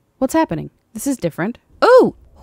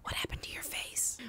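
hushed joking aside; whispered aside; 23 dB quieter than usual. Real, not acted. Teasing aside to viewers about the maiden, whispered. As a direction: whisper it behind her back.

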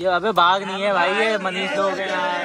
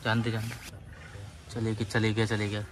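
low, hushed murmur after a scare; 9 semitones lower, 9 dB quieter than usual. Real, not acted. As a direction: keep it down, much lower and softer. Hiding, told not to shout. (दबी आवाज़ में)

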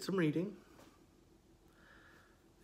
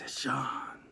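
creepy growl; 3 semitones lower, 7 dB quieter than usual. Retold or imitated. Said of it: "guttural, growly voices".

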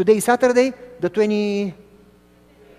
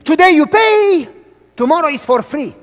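loud high vendor's cry; bellowing ice cream vendor; shouted sales call; 8 semitones higher, 10 dB louder than usual. Retold or imitated. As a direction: call it out like a street seller. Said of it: "he would scream".

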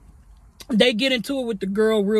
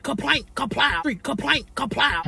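clomping boots sound; 3 dB quieter than usual. Shown as a demonstration. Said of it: "I'm clucking down the street".

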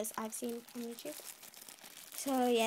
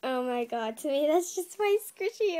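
usual speaking voice

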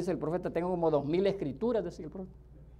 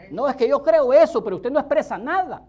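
loud preaching retort; 6 semitones higher, 8 dB louder than usual. Acted. Preacher mimics excuse and reply.

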